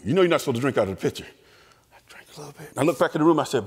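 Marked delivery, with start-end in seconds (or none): 1.9-2.7 hushed, secret whisper; whispered aside. Retold or imitated. Boy telling listeners how he sneaked the Kool-Aid, whispered. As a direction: whisper it like you're sneaking a drink.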